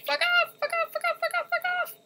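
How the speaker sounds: extremely high, squealed swearing; piercing frightened screech; terrified shriek. Real, not acted. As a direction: squeal it over and over in panic. Shrieking at ghost in chase.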